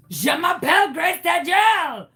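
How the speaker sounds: loud, high French-Canadian caricature with a hearty fake laugh; fake Canadian accent. Caller posing as her Canadian friend, hammed-up French accent. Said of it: "What happened to your accent?".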